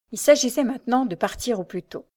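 ordinary delivery

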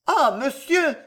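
high, pleading exclamation; anguished cry. A narrator's reading. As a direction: cry out, appalled. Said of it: "s'écria madame de Villefort".

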